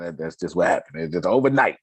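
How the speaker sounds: ordinary talk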